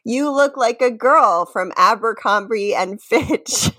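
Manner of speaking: ordinary talk, nothing marked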